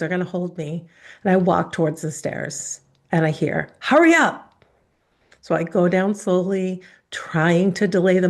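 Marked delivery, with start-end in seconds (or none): 3.8-4.6 high, loud shouted order; father's furious shout. Retold or imitated. Father shouting up the stairs at his daughter, furious yell. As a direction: yell it up the stairs at a scared child.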